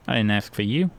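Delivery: put-on dumb Southern accent. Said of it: "mixture between, like, ... Southern mixed with stupid".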